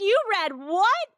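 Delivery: high, outraged "You read what?"; imitating a scandalized mother on the phone; mock horrified mom; shocked mom. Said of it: "she's gonna be like".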